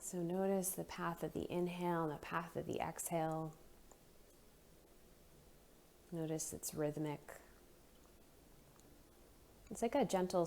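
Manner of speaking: soft, unhurried meditation voice with long pauses; guided breathing meditation. Real, not acted. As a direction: gently guide them into noticing the breath.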